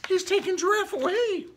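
ordinary delivery, nothing marked

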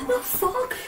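high, startled The fuck?; shocked. Real, not acted. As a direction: blurt it out in shock. Reacts to a shock in the game.

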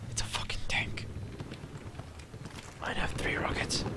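soft tense whisper. Real, not acted. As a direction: hush it in alarm. Gamer spots tank.

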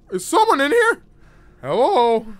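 high loud frightened call; jumpy, calling into the dark; scared horror game streamer. Real, not acted.

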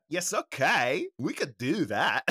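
bad mock European accent, slow start; swooping, overdone European guy accent. Shown as a demonstration. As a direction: do a hammy, vague European accent. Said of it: "Oh, my European guy".

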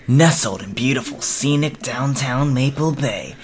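cheesy announcer voice. Acted. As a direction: sell the house like a cheesy ad. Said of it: "into my best cheesy announcer voice".

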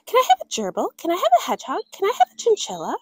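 put-on high, quick begging child; eager pleading. Girl asking parents again, high eager pleading. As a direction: pester for each pet in turn.

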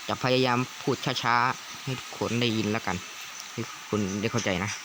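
slow, careful wording; slow down so everyone follows; deliberately slow for the viewers; speaking slowly. Real, not acted.

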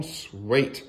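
ordinary delivery

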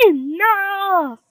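long, high, loud yell; drawn-out shouted command; exasperated mama's shout. Acted. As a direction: explode at the end of your patience. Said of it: "she shouted".

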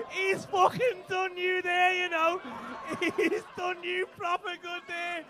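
loud, slow delighted yell; gleeful shout. Real, not acted. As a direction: crow over the burn. Delighted at the heckle.

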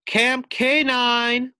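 high, loud, slow called-out stop; barking driver. Acted. Bus driver calling the stop, barked. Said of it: "barks the driver".

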